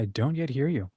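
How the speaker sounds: ordinary talk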